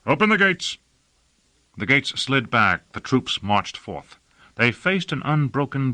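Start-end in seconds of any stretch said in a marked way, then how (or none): 0.1-0.8 high, called-out order; commanding call. Acted. Lord orders the gates opened, raised commanding call.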